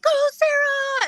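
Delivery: high, loud, stretched-out cheer. Retold or imitated. Friends cheering as she runs in, high loud whoop. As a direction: cheer her on like a crowd.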